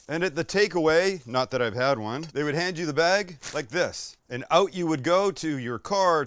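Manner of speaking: ordinary talk, nothing marked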